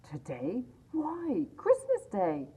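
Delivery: lightly voiced boy, surprised then bright; street boy. A narrator's reading. Boy in the street answering Scrooge, incredulous. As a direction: answer as if he's mad not to know.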